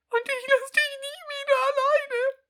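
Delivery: over-the-top sobbing declaration; loud tearful vow. Acted. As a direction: wail the promise in mock tears. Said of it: "sagte ich laut, bestimmend und unter Tränen".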